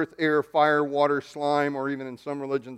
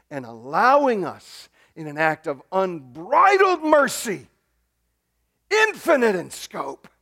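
rising into a louder, higher, slower swell of awe; awed preaching; 5 semitones higher, 6 dB louder, slower than usual. Real, not acted. Preacher overwhelmed by God's mercy, swelling with awe. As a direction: let the wonder carry the voice up.

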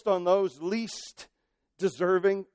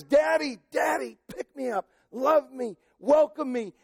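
put-on high, loud childlike pleading; child's cry to father; 6 semitones higher, 3 dB louder than usual. Preacher voicing a believer crying to God, childlike plea. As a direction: call out like a small child reaching for dad. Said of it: "your cry is".

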